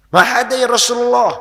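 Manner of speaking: high, loud acted exclamation; imitated eager outburst; eager question. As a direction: call it out eagerly to a revered teacher. Acting out companions' eager question.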